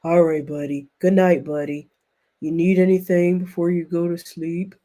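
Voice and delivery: mock him as a lumbering cartoon blob; Grimace impression. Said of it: "sounding like goddamn Grimace".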